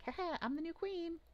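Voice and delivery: high, cackling goblin claim; gleeful goblin. Acted. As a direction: crow it like a gleeful upstart goblin. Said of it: "One of them's like".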